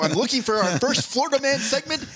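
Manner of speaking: high zany movie-line voice; Jim Carrey quote. Retold or imitated. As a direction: go big and goofy on the line. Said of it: "That was one of his quotes".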